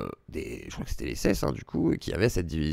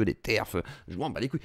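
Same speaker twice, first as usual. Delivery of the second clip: ordinary talk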